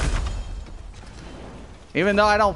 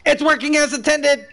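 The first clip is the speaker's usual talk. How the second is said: higher, sarcastic parroting; mocking; 6 semitones higher than usual. Gamer mocks the excuse for a glitch, sarcastic.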